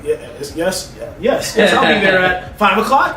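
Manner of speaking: mock eager, proper job-seeker voice.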